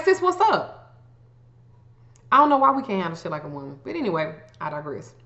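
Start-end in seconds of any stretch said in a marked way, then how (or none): 0.1-1.0 put-on high, bright, bubbly "hey girl" call; friendly sis greeting. Calling a friend to clear the air, with a cheerful high greeting. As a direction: greet her like a long-lost best friend. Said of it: "Call them and be like".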